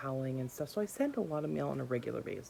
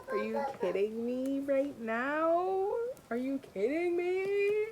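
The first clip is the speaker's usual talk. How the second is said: thrilled disbelief; 9 semitones higher, slower than usual. Real, not acted. Unboxing a gifted box set.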